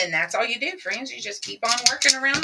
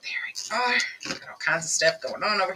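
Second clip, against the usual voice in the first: whispering; 2 semitones lower, 4 dB quieter than usual. Real, not acted. Quiet aside while crafting.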